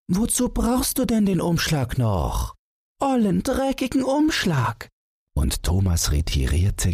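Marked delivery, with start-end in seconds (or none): 0.1-4.9 put-on very high child's voice; little son protesting. A narrator's reading. Son questions father about the envelope, high protesting child's voice. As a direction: argue back as a small boy, pitched way up.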